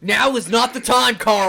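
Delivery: yelling. Pretend. Yelling at Carl over mower.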